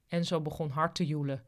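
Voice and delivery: plain reading voice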